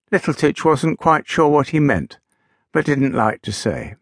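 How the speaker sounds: ordinary delivery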